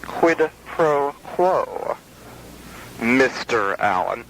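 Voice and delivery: each word stretched out, very slow; slow and drawn out. Pretend. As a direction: savor every syllable, slowly. Repeats the phrase, drawn out.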